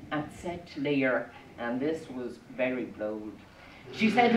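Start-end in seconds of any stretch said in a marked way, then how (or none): none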